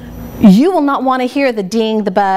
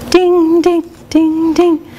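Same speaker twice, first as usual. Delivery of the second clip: imitated bell dings; 5 semitones higher, slower than usual. Shown as a demonstration. Teacher imitates the hotel bell for her students. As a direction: ring it out like a desk bell. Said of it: "I have a bell from, like, at a hotel".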